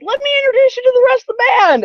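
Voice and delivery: very high, loud, rushed imitation; eager introduction. Singer dragging Janie to the band, mock excited rush. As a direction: gush it fast in a hammed-up high voice.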